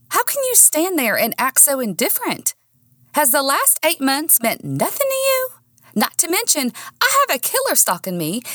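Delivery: high-pitched, wounded indignation; indignant confrontation. A narrator's reading. Woman being dumped confronts Nick, high and indignant. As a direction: pile up accusing questions, pitch climbing high. Heroine confronts boyfriend over breakup.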